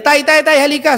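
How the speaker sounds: high, urgent call to his father. Acted. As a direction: call dad over in mock alarm. Boy calls father urgently. (nagmamadaling tawag sa tatay)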